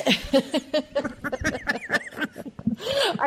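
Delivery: high rolling burst of laughter; laughing. Real, not acted. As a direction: break into a long, high laugh. Amused reaction to guest.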